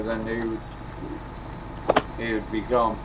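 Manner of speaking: ordinary talk, nothing marked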